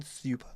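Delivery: "super" mockingly said as "syoo-per". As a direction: slip a y sound after the s in "super". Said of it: "Where's the Y in super?".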